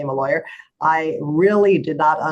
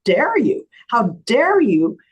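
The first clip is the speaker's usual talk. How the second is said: slow, indignant retold outburst, pitch jumping on DARE; pissed off; 6 semitones higher, slower than usual. Lawyer defending her scared client to opposing attorney, pissed off. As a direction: stretch out an outraged "how dare you". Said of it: "I was really pissed off".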